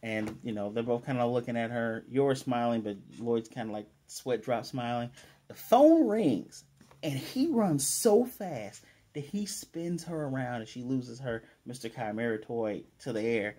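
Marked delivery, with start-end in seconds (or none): 5.5-10.0 dramatic. A narrator's reading. Dramatic ringing phone moment.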